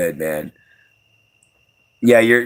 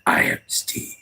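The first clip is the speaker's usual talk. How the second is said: much lower, voiceless whisper; deeper whisper; 6 dB quieter than usual. Shown as a demonstration. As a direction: drop it way down and whisper it. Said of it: "in this case, it'd be more like".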